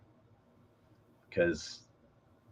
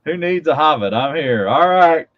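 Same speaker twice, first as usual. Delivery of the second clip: hammed-up announcement; 6 semitones higher, 8 dB louder than usual. Hammed-up greeting as Irish viewer arrives.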